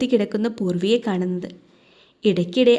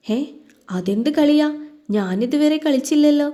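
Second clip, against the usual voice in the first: higher-pitched puzzled questioning; 6 semitones higher than usual. A narrator's reading. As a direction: play innocent, pitch lifted in puzzlement. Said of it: "നെറ്റി ചുളിച്ചുകൊണ്ട് ചോദിച്ചു". (സംശയത്തോടെ ഉയർന്ന സ്വരത്തിൽ)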